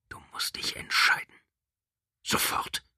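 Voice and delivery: whispered creature demand; chupacabra. Acted. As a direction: hiss it, cold and urgent. Creature presses her to choose.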